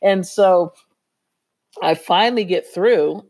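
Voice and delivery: ordinary talk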